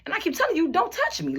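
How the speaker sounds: imitated upset protest, building to a high pitch; retold screaming protest. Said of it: "Now I'm really screaming".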